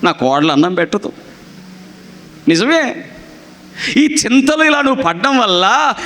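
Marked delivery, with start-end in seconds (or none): 0.0-1.1 imitating a grieving mother-in-law; mock lament. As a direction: sigh it out as if feeling sorry for yourself. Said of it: "బాధపడిపోతుంటారు".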